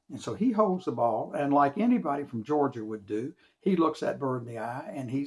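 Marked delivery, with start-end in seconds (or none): none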